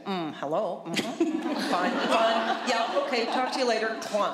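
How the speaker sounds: lightly voiced parrot doing the husband's phone call; parrot imitating husband on phone. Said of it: "the phone would ring, and she'd say".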